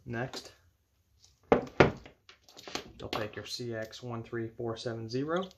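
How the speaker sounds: normal talking voice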